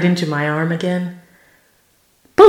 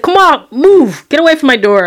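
angry; 9 semitones higher, 5 dB louder than usual. Retold or imitated. Telling the woman in pink to leave, angry and loud. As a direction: shove the words out, fed up. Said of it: "I was so mad".

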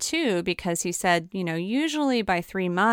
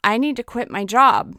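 a sudden, louder blurt with feeling breaking through; 4 semitones higher, 6 dB louder than usual. Retold or imitated. To her nutritionist, a sudden emotional blurted confession. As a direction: let it burst out before you can stop it. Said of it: "I just sort of blurted out".

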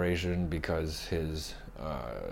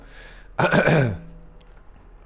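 throat clearing; 9 semitones higher, 7 dB louder than usual. Real, not acted. Sick vlogger clears sore throat.

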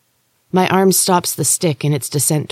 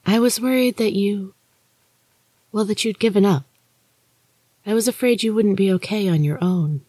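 Maria's ghostly voice; 6 semitones higher than usual. Acted. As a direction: lift it and soften it, an eerie sister warning. Maria speaks in the story.

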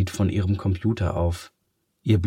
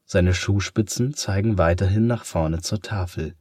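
ordinary delivery

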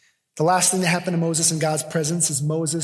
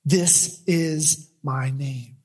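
voice of God; 4 semitones lower, slower than usual. Acted. Preacher voicing God revealing his name to Moses, hushed and slow. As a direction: speak God's words low and slow, almost in awe. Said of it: "He says".

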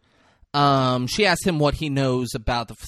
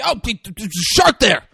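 mock panic; 10 semitones higher, 8 dB louder than usual. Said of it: "He's like".

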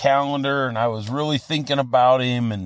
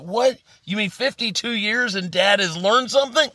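much higher, hammed-up disbelief; a pitched-up, teasing "What?" of fake amazement; mock astonishment; 6 semitones higher than usual. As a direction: play up the shock to tease your son. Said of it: "I wanted to say".

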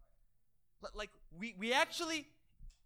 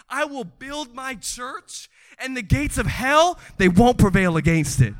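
declaimed scripture; 14 dB louder than usual. Acted. Preacher quoting Jesus on his church, building declamation.

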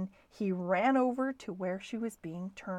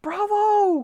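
high, loud cheer; cried out; 12 semitones higher, 10 dB louder than usual. A narrator's reading. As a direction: cheer it out with full approval. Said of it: "cried the man".

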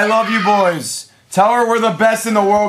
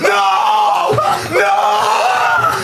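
anguished shout; 8 semitones higher than usual. Real, not acted. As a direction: yell at the bad beat. Losing a hit.

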